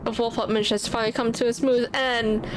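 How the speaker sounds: ordinary delivery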